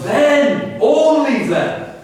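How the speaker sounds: then punched high, only then drawn out very slow; emphatic only then. Acted. Preacher driving home the Hebrew's emphasis, slow and strong. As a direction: hammer each word of only then. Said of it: "You could almost say".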